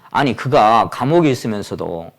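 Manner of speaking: normal talking voice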